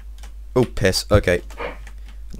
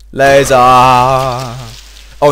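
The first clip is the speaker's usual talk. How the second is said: excited shout; 3 semitones higher, 8 dB louder, much slower than usual. Real, not acted. Announcing a weapon.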